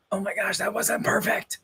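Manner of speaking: high-pitched mock perfectionist panic; mock fretting. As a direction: fret in a small high voice, hammed-up. Acts out perfectionist worry in quote.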